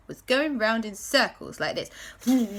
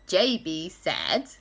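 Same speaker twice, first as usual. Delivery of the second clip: ordinary delivery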